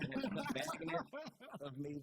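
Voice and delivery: high, slow, laughing words; real laughter. Real, not acted. Telling the host about strangers' cars, high and laughing. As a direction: laugh at how absurd it is.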